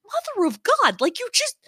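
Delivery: high, strained self-scolding; hammed-up frustration at own body; exasperated high-pitched outburst, played for laughs; mock exasperation. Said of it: "I'm sitting here, I'm like".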